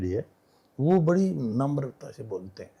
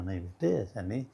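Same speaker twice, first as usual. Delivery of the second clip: put-on softer, lower, gentle correction to a child; lovingly; 6 semitones lower, 10 dB quieter than usual. Grandfather gently correcting his granddaughter, soft and loving. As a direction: coax the child kindly, softly. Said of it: "प्यार से बोलते हैं".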